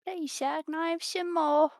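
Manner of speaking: high, pleading Cockney voice, clearly put on; Cockney orphan. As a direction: beg like a Cockney orphan asking for food.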